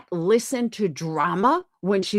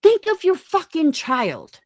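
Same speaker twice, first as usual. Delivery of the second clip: higher and louder, scolding hard; angry shout; 8 semitones higher, 4 dB louder than usual. Real, not acted. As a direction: bark it like a furious scolding. Angry rant at a mother.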